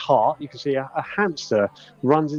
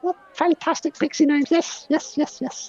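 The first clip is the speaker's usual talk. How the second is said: pixie voice. Acted.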